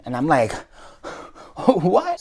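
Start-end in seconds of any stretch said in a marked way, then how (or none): 1.7-2.2 high-pitched, incredulous question; stunned disbelief. Retold or imitated. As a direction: let it leap up in amazed surprise. Retold shock at the total.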